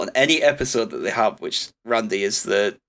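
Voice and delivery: ordinary delivery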